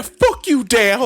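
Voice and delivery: high yelled fuck you at the stepdad; the drunk ex-husband snarls at the stepdad, loud and high; drunk shout. Acted. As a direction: lash out like a drunk Boston guy. Drunk Mikey snaps at Dale.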